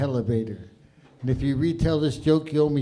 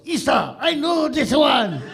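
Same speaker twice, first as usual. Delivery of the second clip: eager dim Larry; 10 semitones higher, 6 dB louder than usual. Acted. First Larry at heaven's gate, eagerly laughing. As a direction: blurt it out like a proud simpleton.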